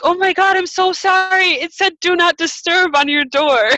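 high, loud gushing apology; frantic apology. Retold or imitated. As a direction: blurt it out in a mortified, high-pitched rush of sorry. Acting out her frantic apology.

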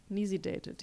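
ordinary talk, nothing marked

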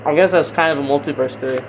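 usual speaking voice

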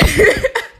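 short high giggle at own word; embarrassed laugh. Real, not acted. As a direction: laugh at yourself. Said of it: "I laugh when I say inspired".

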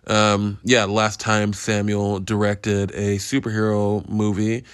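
normal talking voice

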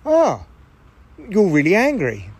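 lightly voiced realisation, high swooping "Ah"; a hint of curious detachment; detached inner observer. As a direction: remark on it like an onlooker to your own temper. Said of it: "part of you that stands apart from the anger and goes".

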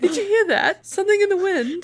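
high-pitched, mock wondering voice; puzzled prince. Acts the prince hearing something, high mock puzzlement. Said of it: "He's like".

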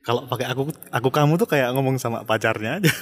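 ordinary delivery

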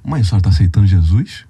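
retold question, pitched low and pressed; asking mom up close. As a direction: put the question straight to your mother, low and loud. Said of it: "fingi que ela é o microfone".

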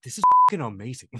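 loud, fast, hyped shout; excited. Retold or imitated. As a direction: blurt it out thrilled and fast. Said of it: "we're like".

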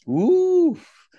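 loud, high-pitched "ouf"; big whooping exhale. Real, not acted. Coach floored by the Olympic medal question, big high whoop. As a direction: let out a huge, high whoop of overwhelm.

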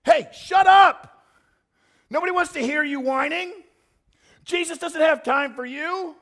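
angry yelling. Acted. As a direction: yell down a noisy nuisance. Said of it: "the people in front of him yelled".

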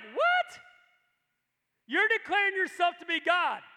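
high, loud incredulous "What?"; mock outrage. Pharaoh hearing Moses claim the name, shocked and loud. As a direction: act out Pharaoh's shocked disbelief. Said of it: "they're gonna be like".

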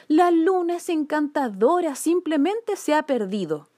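put-on girl protesting. A narrator's reading. Girl defends the moon to policeman, high earnest protest.

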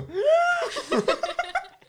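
high wheezing gasp of laughter. Shown as a demonstration. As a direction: suck air in through a tight laugh. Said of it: "macht dann so".

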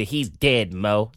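low imitation of her blunt news; retold blunt news. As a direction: drop the pitch, imitating her. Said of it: "she gave me the".

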